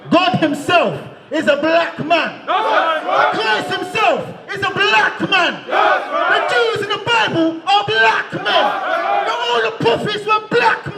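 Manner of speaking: slow, shouted, rising sermon cadence; preaching at full force. Acted. Street preacher shouting to the crowd, full-force preaching. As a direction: hammer each claim home like a street preacher.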